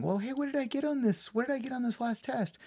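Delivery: high, soft voice of a schoolkid; kid asking teacher. Retold or imitated. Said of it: "going to my fifth grade teacher and saying".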